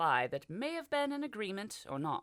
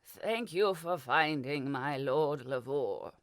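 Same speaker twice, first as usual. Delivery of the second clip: put-on old butler; 2 semitones lower, 4 dB louder, slower than usual. A narrator's reading. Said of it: "the ancient butler said".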